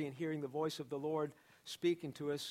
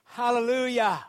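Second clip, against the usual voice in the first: loud, high hallelujah cry; excited shout; 5 semitones higher, 11 dB louder than usual. Real, not acted. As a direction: shout it out in praise. Preacher's exclamation in sermon.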